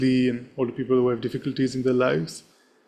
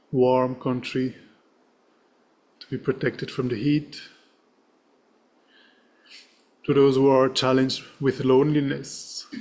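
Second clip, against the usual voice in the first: serene. Acted. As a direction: read the dedication gently with long pauses. Leads a dedication prayer.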